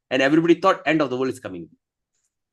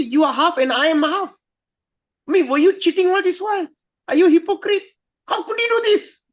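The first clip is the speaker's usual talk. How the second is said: high, hurt accusation; angry Parvati; 13 semitones higher, 4 dB louder than usual. Acted. Parvati accusing Shiva of hypocrisy, high and angry. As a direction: accuse him of cheating, voice climbing. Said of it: "she become very angry".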